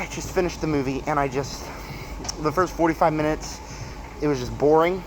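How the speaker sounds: ordinary talk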